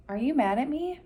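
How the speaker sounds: high, anxious blurt; blurted in panic. A narrator's reading. Opal panics before the bee, high blurted question. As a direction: drop everything and blurt it out. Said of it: "She dropped the flowers and blurted".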